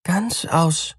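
high, startled echo; astonished mouse. A narrator's reading. Mouse echoing the name, high and astonished. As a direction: cry the name out in disbelief. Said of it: "rief die Maus".

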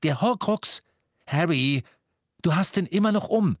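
high, insistent exclamation; excited, impatient alarm; urgent realisation. A narrator's reading. Hermine urgently realises he wears it.

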